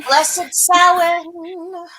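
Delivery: solemn drawn-out blessing. Acted.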